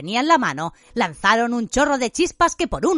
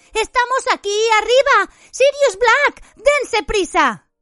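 high, loud drawn-out cries; screaming; 14 semitones higher, 5 dB louder, slower than usual. A narrator's reading. Hermione calling for help from upstairs, screaming. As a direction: yell urgently for rescuers. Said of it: "gritó Hermione de pronto".

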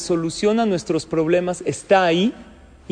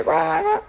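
put-on baby wail; 14 semitones higher than usual. Imitating a crying baby.

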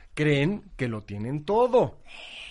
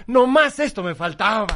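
loud, higher mock-exasperated outburst; exasperated father; 6 semitones higher, 7 dB louder than usual. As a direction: play a dad who has had enough. Exasperated father quoted in joke.

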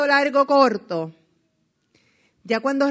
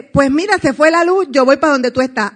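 louder and higher, eager phone call; excited caller; 4 semitones higher, 8 dB louder than usual. Retold or imitated. The leader eagerly telling her he's coming over.

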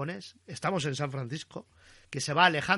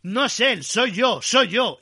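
loud high desperate yell; 7 semitones higher, 12 dB louder, slower than usual. Retold or imitated. Frank shouting at Kido to save his friend, loud high yell. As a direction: scream the confession at him. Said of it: "y le chilla a Kido".

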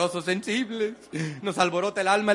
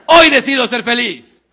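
strong affirmation shout; 2 semitones higher, 10 dB louder than usual. Shown as a demonstration. Speaker leads a motivational chant, shouting it loud. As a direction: proclaim every line with full force. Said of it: "repitan mis movimientos y mi tono de voz".

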